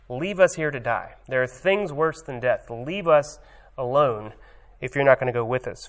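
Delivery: ordinary delivery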